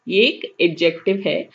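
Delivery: ordinary talk, nothing marked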